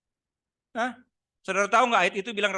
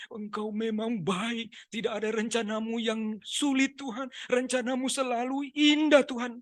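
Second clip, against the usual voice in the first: slow, put-on weepy prayer; tangis dibuat-buat; 2 semitones higher, 3 dB quieter than usual. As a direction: plead with God in fake tears, drawn out. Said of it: "Dibuat-buat seperti menangis pokoknya". (doa merengek pura-pura menangis)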